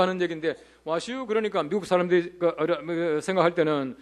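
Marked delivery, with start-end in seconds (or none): 0.8-1.3 Chungcheong dialect. Retold or imitated. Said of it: "충청도 아저씨가".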